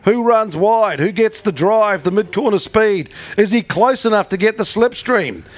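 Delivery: rising, building excited race call; race caller. Real, not acted. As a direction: call the photo finish, climbing to a shout. Live race finish call.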